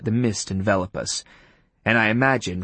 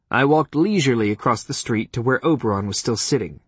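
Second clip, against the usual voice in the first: ordinary delivery, nothing marked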